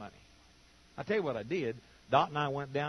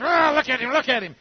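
loud, higher bitter grumble; resentful muttering; 7 semitones higher, 11 dB louder than usual. Retold or imitated. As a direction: sneer at the man across the room. Mocking the grudge-holder's resentment.

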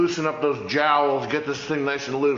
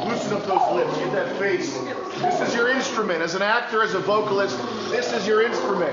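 pumped-up, higher, driving; energetic coach; 5 semitones higher than usual. Acted. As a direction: fire up the class. Coach pumping up the class.

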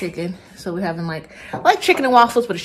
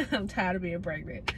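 said again through a laugh; tired, half-laughing repeat; 8 dB quieter than usual. Real, not acted. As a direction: laugh the words out as you repeat them.

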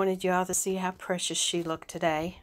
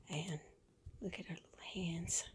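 whispering; 9 dB quieter than usual. Real, not acted. Hushed so doll stays asleep.

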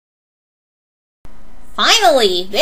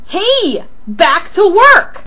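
loud, high, drawn-out order; bossy yell; 8 semitones higher, 5 dB louder, slower than usual. Acted. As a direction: call them sharply back to their job. Ordering the other back to work.

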